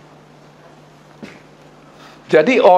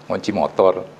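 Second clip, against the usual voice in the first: ordinary delivery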